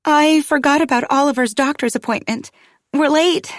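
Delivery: high-pitched fluster; frantic, panicky. A narrator's reading. Mother telling Paul they're late for the doctor, frantic. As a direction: blurt it out in a rising panic. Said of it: "a flutter of panic, franticness in my tone".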